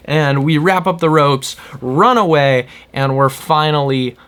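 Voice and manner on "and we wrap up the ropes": ordinary talk, nothing marked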